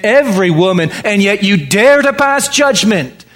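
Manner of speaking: fervent sermon delivery; preaching. Acted. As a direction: preach it out to the congregation. Raised preaching passage.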